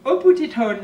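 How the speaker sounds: loud, showy English accent; hammed-up English accent. Shown as a demonstration. Vlogger reading out a shade name, hammed-up English accent. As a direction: put on your best English accent. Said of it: "avec mon super accent".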